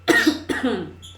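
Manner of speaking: harsh choking cough; coughing fit. Real, not acted. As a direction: cough to clear the throat. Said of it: "I think I swallowed a bug just now".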